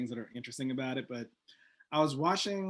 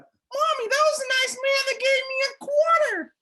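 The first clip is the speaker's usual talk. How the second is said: put-on very high, louder child talking to mom; little kid voice; 7 dB louder than usual. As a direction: pipe it up like a six-year-old. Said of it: "if it was a six-year-old kid".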